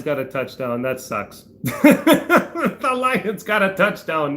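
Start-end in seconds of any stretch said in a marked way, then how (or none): 1.6-4.4 repeats it slowly through a laugh; amused disbelief, drawn out through laughter. Real, not acted. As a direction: laugh it out, higher and slow. Repeats news while laughing.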